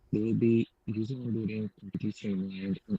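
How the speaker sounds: muffled, like through a hand; muffled microphone sound; muffled mic. Real, not acted. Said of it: "you went muffled".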